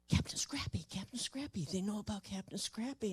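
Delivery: excited hushed whisper to a colleague; secretly nudging a neighbour. Retold or imitated. Officer whispering to the officer beside him.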